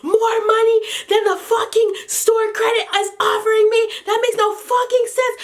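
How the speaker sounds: high, heated ranting yell; angry rant. Retold or imitated. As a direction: tear into the sales reps. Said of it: "I'm yelling in the middle of the store".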